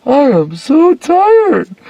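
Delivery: hammed-up exhausted traveller, yawning; yawning tired light. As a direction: play a weary photon whining on a long trip. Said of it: "yawning and going".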